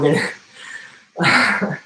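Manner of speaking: breaking into real laughter mid-sentence, slowly; words dissolving into a giggle; laughing. Real, not acted. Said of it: "I'm just laughing".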